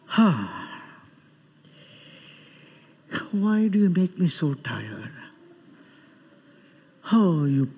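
low, sighing complaint; mock being worn out by the congregation; imitated weary pastor. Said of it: "have you ever seen him talking like that".

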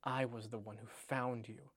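slow, lightly sulky murmur; sulky edge. A narrator's reading. Reading Wormtail's complaint aloud, mildly sulky. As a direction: understate the sulk, low and soft at first. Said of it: "a sulky edge to his voice now".

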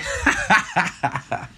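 breathy run of amused laughter; real laugh. Real, not acted. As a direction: laugh along, eager for the story. Laughing while urging the story on.

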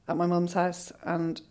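ordinary talk, nothing marked

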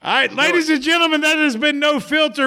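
upbeat announcer outro; show host sign-off. Acted.

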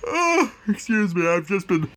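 yawning through the apology; put-on yawn. Cohost excuses himself for being tired today, yawning. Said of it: "tired today".

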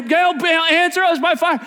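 high, loud plea for fire; loud pleading. Acted. Prophets begging Baal for fire, loud high plea. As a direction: cry out for fire.

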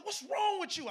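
high retold scolding; acted parental exasperation; frustrated parent. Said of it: "'cause I was frustrated by the decision".

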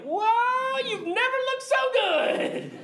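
high, loud, drawn-out awestruck gush; hammed-up squeal of admiration; amazed delight. Preacher acting out a reaction to restoration, amazed delight.

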